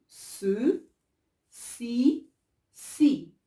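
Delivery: "ce", "si", "si" with a clear S; slow, loud teaching of "ce, si, si"; soft C sound. Shown as a demonstration. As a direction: model each syllable separately, S sound in "si". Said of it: "Je dis".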